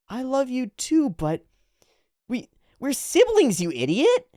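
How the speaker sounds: high, drawn-out protest; exasperated brother character. Acted. Brother character answering his sister.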